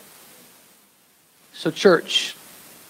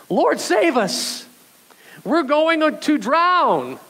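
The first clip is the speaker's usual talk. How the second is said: high, slow, hammed-up cry for help; panicked plea; 11 semitones higher, much slower than usual. Pastor acting disciples begging Jesus in the storm. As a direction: wail it like disciples sure they will drown. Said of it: "I think it's more like shouting".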